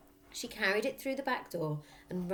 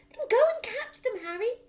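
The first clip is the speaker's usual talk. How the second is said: very high, loud pushy voice; wife prodding husband to act; urgent prod; 10 dB louder than usual. Acted. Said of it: "hissed the mother".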